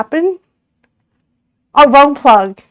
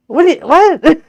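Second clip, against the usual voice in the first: ordinary delivery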